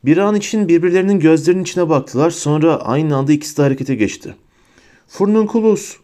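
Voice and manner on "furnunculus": high-pitched cry of an incantation; shouted spell. A narrator's reading. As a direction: fire off the spell in a raised voice. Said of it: "diye bağırdı Harry".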